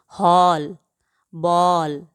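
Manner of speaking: हॉल बॉल with open rounded ऑ vowel; English o sound. Shown as a demonstration. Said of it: "अंग्रेजी से हिंदी में आए".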